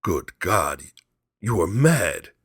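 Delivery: husband horrified at his wife; aghast cry. A narrator's reading. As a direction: cry out in shock. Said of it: "he cried aghast".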